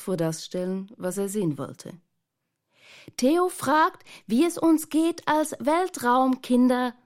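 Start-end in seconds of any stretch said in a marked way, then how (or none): 3.2-6.9 put-on high, loud child's shout through a metal helmet. A narrator's reading. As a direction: yell it so he hears through the tin. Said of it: "schrie Miranda".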